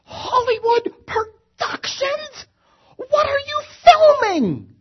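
hammed-up startled driver bellowing; yelling. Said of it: "he yells at the top of his lungs".

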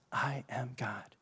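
hushed whispered aside; preacher whispering the key point to the congregation. Real, not acted. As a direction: drop to a whisper for the reveal.

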